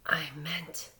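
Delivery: winded boy, barely voiced; weak gasping whisper. A narrator's reading. Hurt boy on the ground whispers to his friends. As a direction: gasp the words out, no breath left. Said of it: "weakly and gravely in gasps".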